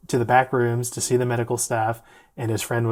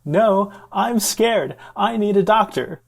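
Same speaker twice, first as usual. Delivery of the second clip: high, loud, drawn-out retold panic; mock scared yell; 8 semitones higher, 6 dB louder, slower than usual. Distressed patient protesting to his friend, scared yelling. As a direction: imitate a patient wailing for help. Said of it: "this poor guy is yelling".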